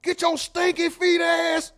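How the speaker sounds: high, loud slow furious yell; high furious shout, slowed; wife yelling on mute. Retold or imitated. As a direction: scream with the mute on. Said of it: "Hit that mute button".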